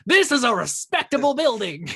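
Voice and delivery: high, loud mock-outraged guard; hammed-up protest from an offended guard; indignant guard. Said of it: "And the guard's like".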